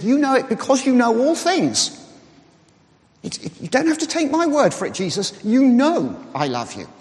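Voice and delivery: emphatic, high-pitched protest of "You know"; emphatic insistence. Retold or imitated. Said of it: "he was really emphatic about it".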